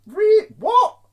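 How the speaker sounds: high, loud burst of disbelief; incredulous. Acted. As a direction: cut in, loudly baffled. Breaks off mockery in disbelief.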